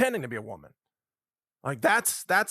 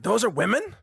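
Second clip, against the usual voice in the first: higher, louder incredulous question; disbelieving question, raised in pitch; mock disbelief; 6 semitones higher, 5 dB louder than usual. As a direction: act out a baffled spectator. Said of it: "You're like".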